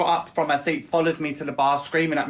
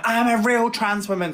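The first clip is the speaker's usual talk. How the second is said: mock screaming; 8 semitones higher, 3 dB louder than usual. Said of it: "screaming in my face".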